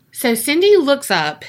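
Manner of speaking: plain reading voice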